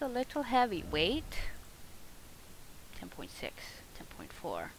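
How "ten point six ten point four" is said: soft whispered numbers. Real, not acted. Gamer whispering weight numbers while checking gear. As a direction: whisper the figures under your breath. Reading weight stats aloud.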